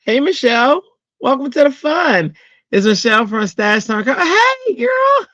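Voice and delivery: high, loud excited hello to a friend; excited greeting. Real, not acted. Greeting Michelle as she joins the stream, excited high calling out. Greeting a viewer joining live.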